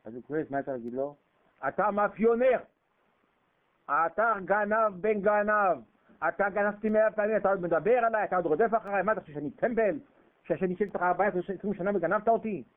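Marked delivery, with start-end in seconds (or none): 1.7-12.6 loud, high furious tirade at a cheat; angry accusation. Acted. Jacob shouting at Laban that he cheated him. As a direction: yell it in his face, indignant.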